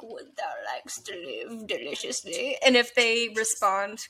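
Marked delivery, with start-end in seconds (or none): 0.0-2.6 hushed, creepy whispered quote. Retold or imitated. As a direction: breathe it like a tempting devil. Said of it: "just to be like".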